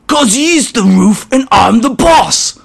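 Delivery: high, loud, slow goblin retort; stern grot. Acted. Icky boasting to the smaller grot, stern retort. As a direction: snap back cocky as a runt. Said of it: "Icky answered with a stern look".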